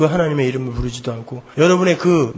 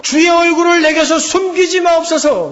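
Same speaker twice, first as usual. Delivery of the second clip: high, shouted plea; crying out in prayer; 9 semitones higher than usual. Acted. Preacher voices David begging God to stay, crying out. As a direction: cry out to the Lord, pleading. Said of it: "그게 다윗의 기도예요".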